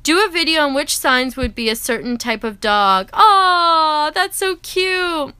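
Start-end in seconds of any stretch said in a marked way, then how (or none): 3.1-5.3 cooing. Real, not acted. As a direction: coo over something cute. Reading comment, cooing.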